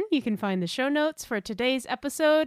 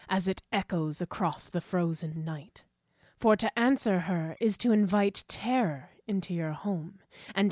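slow, dark dramatic poem reading; poetry reading; 4 semitones lower, slower than usual. Acted. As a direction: read it like a spooky poem. Reads her horror poem.

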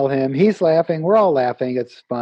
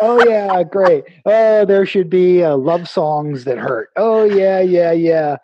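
louder excited riffing; 3 semitones higher, 4 dB louder, slower than usual. Retold or imitated. Riffing jokes, laughing loudly.

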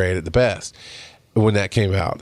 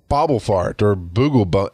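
normal talking voice

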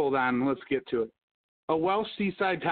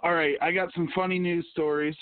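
normal talking voice

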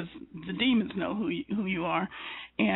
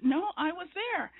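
much higher, incredulous insistence; insistent surprise; 8 semitones higher than usual. Retold or imitated. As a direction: protest it in disbelief. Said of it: "I went".

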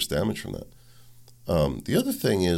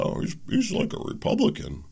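mocking quote. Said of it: "there was people that".

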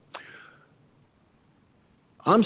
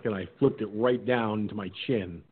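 usual speaking voice

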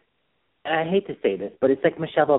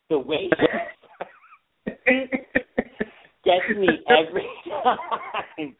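high, laughing through the words; can't stop giggling while saying it; real laughter breaking the line; 12 semitones higher than usual. Real, not acted. Laughing at the movie.

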